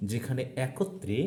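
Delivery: usual speaking voice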